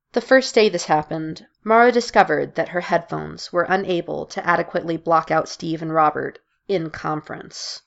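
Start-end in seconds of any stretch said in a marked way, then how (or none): none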